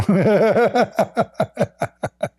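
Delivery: bursts of high laughter; laughing at the bedroom joke. Real, not acted. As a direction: laugh hard at the punchline. Laughs at his own story.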